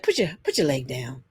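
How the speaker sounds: low-pitched, mildly disgusted order; imitated disgust. Man telling woman in the car, mock disgust. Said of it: "He was disgusted".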